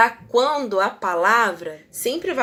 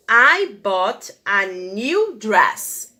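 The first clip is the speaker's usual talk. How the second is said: very slow, word by word; teacher reading the example; slow model sentence; 3 dB louder, much slower than usual. Shown as a demonstration. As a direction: read it slowly for the class. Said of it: "frase que eu coloquei".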